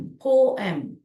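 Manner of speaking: पोएम said whole as po-em with a firm fall; joined pronunciation. Shown as a demonstration. Teacher rejoining the syllables of पोएम for the class, firm. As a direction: put पोएम back together as po-em. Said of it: "that's how you pronounce it".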